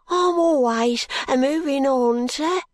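put-on high, tearful pleading boy; a ragged boy crying and begging. A narrator's reading. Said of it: "cries the boy, wiping away his grimy tears".

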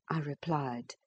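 plain reading voice

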